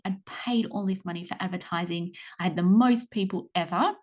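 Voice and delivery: usual speaking voice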